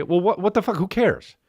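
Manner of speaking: high, defensive acted retort; indignant protest. Husband brushes off the complaint about his sign, indignant.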